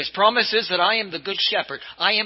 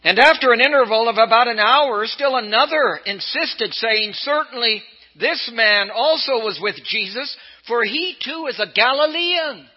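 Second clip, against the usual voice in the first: raised, high, insistent scripture reading; loud accusing read; 7 semitones higher, 6 dB louder than usual. Acted. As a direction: read it like an accuser calling him out. Reading the accuser's words from Luke.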